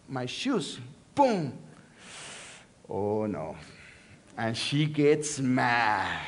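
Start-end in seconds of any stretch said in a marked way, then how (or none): none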